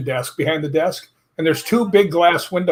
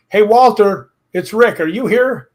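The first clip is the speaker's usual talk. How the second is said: raised, higher voice hailing a spirit; calling out; 5 semitones higher, 5 dB louder than usual. Retold or imitated. Investigator calling his ghost friend Walter, calling out. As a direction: call into the room for an unseen friend.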